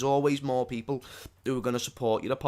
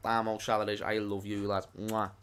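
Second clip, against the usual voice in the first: cooing fondly; 6 semitones lower, 7 dB quieter than usual. Real, not acted. Cooing at the little figurine.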